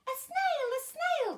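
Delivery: high, loud alarmed cry; startled by a snail on the board; shocked teacher. A narrator's reading. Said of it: "the teacher turns pale".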